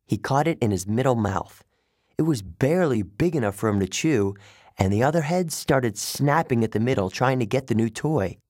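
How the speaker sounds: ordinary delivery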